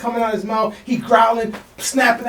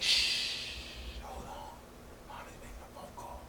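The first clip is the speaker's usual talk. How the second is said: put-on hushed whisper to a child; 20 dB quieter than usual. Man hushing the toddler so mom can talk, whispered. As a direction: shush the kid in a breathy whisper. Hushing baby during phone call.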